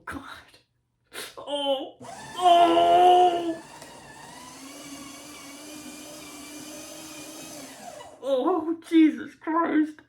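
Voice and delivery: high horrified cries; nauseated horror. Real, not acted. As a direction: recoil in disgust. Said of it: "I think I'm gonna be sick".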